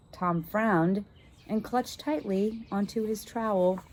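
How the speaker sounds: ordinary delivery, nothing marked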